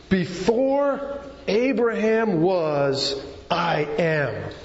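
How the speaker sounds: drawn-out, weighty delivery with long pauses; slow it right down, let each phrase land; emphatic scripture declaration. Real, not acted. Preacher solemnly declaiming the key verse.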